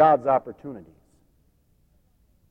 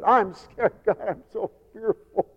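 higher, soft frightened leper; mock fearful; 5 semitones higher, 4 dB quieter than usual. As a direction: act a hushed little whimper of fear. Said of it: "they, they're fearful".

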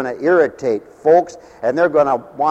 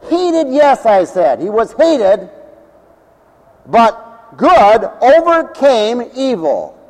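raised, louder preaching voice; 8 semitones higher, 6 dB louder, slower than usual. Acted. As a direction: proclaim it to the congregation. Preacher building the sermon.

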